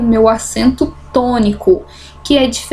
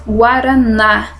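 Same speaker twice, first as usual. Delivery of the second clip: "guaraná" slow, landing on a long NÁ; drawn-out last syllable in "guaraná"; stress on last syllable; slower than usual. Shown as a demonstration. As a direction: lean on the final NÁ of "guaraná". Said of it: "sílaba forte".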